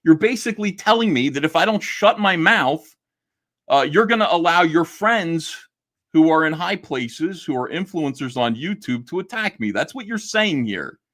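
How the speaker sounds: high, insistent, worked-up retelling of his own words; heated retelling. Retold or imitated. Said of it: "I go".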